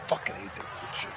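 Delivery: muttered curse. Real, not acted. Player swears after bad shot.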